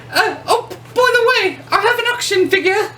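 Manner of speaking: very high, giggly British grandma voice; chirpy British lady with a laugh; British accent. Retold or imitated. Imitating the British actress offering her action figure, in a very high, laughing British voice. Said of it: "she picked it up and said".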